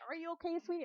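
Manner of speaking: high, cooing imitated concern; concerned coach. Coach checking on hurt player, cooing mock concern. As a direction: fuss sweetly in a high voice.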